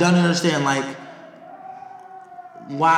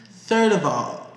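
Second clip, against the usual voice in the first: ordinary talk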